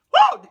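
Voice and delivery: high, loud preacher's whoop; ecstatic woo. Real, not acted. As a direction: whoop it out in fervor.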